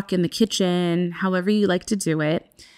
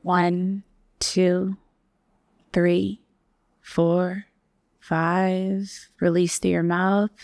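guided meditation. Acted. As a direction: ease listeners into stillness. Counts a breath hold.

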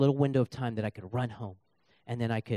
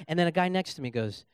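ordinary delivery

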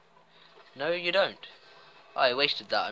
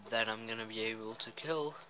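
normal talking voice

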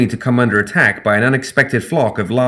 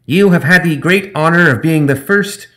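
high, loud grandstanding gremlin; shouting gremlin; 7 semitones higher, 4 dB louder than usual. Acted. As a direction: proclaim it from the sky. Zip shouting while flying.

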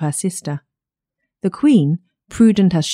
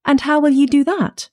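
high-pitched eager question; an exclaimed query, pitched well up; crying out; 7 semitones higher than usual. A narrator's reading. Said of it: "cried the princess".

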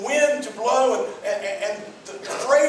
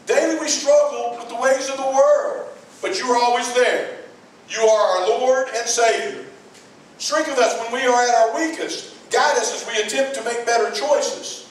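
raised, lifted praise for creation; read-out prayer of praise; 3 semitones higher, 3 dB louder than usual. Shown as a demonstration. Preacher reads an example praise prayer, lifted and sincere. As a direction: lift up thanks for the oceans and clouds. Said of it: "How about this one?".

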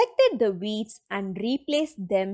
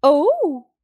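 Pooh's surprised voice; 3 semitones higher, 6 dB louder than usual. A narrator's reading. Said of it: "Pooh said".

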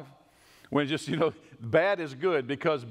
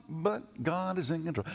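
tacked-on afterthought; 2 semitones higher, 4 dB quieter than usual. Shown as a demonstration. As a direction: mumble it like a footnote. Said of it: "like it's a little afterthought".

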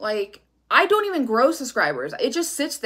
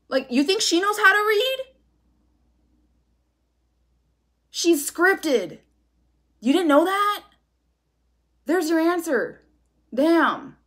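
much higher, sneering disbelief; incredulous mockery; 7 semitones higher than usual. Real, not acted. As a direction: scoff at the rival reader, pitch way up. Mocking a rival tarot reader.

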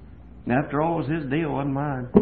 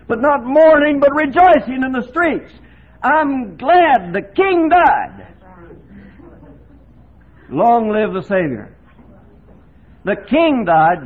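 loud, high, fervent pulpit voice; full-force preaching; 12 semitones higher, 12 dB louder, slower than usual. Acted. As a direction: preach it out to the hall with fire.

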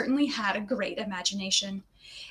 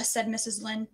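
ordinary delivery

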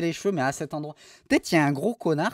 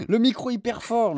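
ordinary talk

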